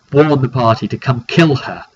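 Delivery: ordinary delivery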